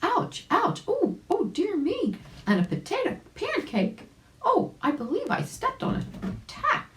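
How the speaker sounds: put-on high yelping old rabbit, stung by a tack; rabbit crying out in pain. Old rabbit who stepped on a tack, crying out high. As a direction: yelp it high, then fuss about careless Sammy. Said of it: "he cried out".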